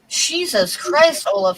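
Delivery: high, drawn-out astonishment; amazed exclamation. Acted. Fisherman sees his friend's giant lighter, amazed exclamation. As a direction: exclaim slowly in mock amazement.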